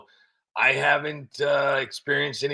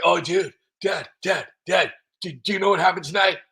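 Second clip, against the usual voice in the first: excited son; 5 semitones higher, 4 dB louder than usual. Retold or imitated. Son telling dad excitedly.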